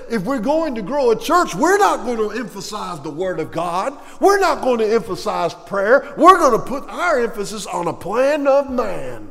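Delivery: loud, high-pitched insistent proclamation; emphatic mock declaration. Church leaders announcing their new growth plan, loud mock declaration. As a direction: declare it loudly, hammering each refusal.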